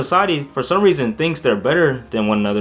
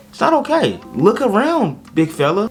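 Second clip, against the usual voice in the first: high, pointed call-out; emphatic scolding; 7 semitones higher than usual. Real, not acted. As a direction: scold the audience.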